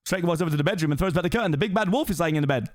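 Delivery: ordinary talk, nothing marked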